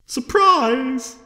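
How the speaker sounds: high, loud gleeful shout; happy exclamation. A narrator's reading. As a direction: burst out with delight. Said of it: "I exclaim in happy proclamation".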